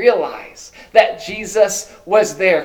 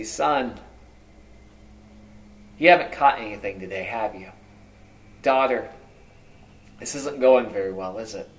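fatherly; 6 semitones lower than usual. Acted. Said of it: "it's like a fatherly title".

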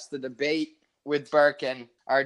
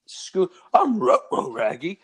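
slow cartoon dog voice, ruh-roh; Scooby-Doo voice; 5 dB louder, slower than usual. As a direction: play Scooby-Doo, dragging the words. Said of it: "I didn't break character".

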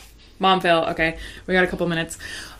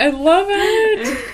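high, stretched, laughing; laughing delight; 13 semitones higher, 7 dB louder, much slower than usual. Real, not acted. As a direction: gush while laughing.

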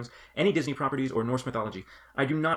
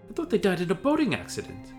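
surprised; 7 semitones higher than usual. Acted. Startled hobbit.

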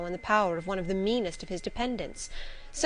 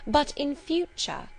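"but in future" slow and raised, heavily stressed; pointed, higher emphasis on "future"; marked stress; 7 semitones higher, slower than usual. A narrator's reading. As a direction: lean hard on "in future", drawing it out. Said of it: "I laid marked stress on the words".

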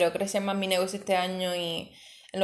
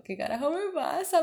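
much higher, startled self-quote; half-mock panic at your own mood; bewildered outburst; 9 semitones higher than usual. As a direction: ask it like a lost, overwhelmed kid. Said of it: "Me sentía como una fucking niña".

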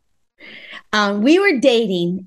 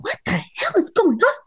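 baffled; 9 semitones higher than usual. Retold or imitated. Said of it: "I'm like".

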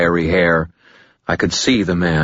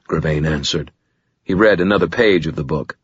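ordinary delivery, nothing marked

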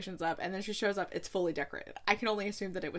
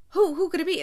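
louder, higher, breathless wondering; frantic guessing; 8 semitones higher, 8 dB louder, faster than usual. Retold or imitated. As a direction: race through the suspects out loud.

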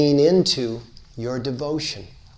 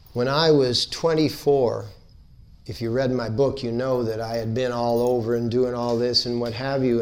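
ordinary talk, nothing marked

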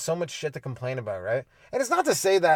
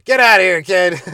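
much louder dismissive yell, a bit higher; loud scoffing brush-off; mock shout; 4 semitones higher, 11 dB louder than usual. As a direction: shout the kid off. Shooing the kid away.

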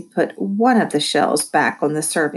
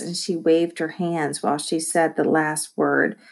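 plain reading voice

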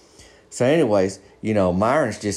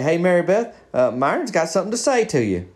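pitched-up hail to a woman; retold call-out; 7 semitones higher than usual. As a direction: put your shy pal on the spot, voice raised in pitch. Friend puts shy pal on spot.

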